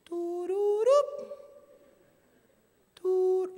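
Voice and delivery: high, loud, drawn out; mock suspense jingle; comic dun-dun sound. Real, not acted. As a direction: sing a slow dramatic sting. Comic dramatic sound effect.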